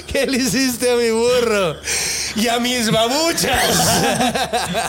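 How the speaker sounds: slow, hammed-up giant demanding answers; mock giant voice. Giant to the man who freed him, slow mock outrage. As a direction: drag it out like an offended giant.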